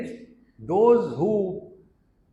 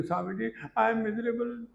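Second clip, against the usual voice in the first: whining complaint; 7 semitones higher, 5 dB quieter, faster than usual. Retold or imitated. Lady complaining, retold by swami.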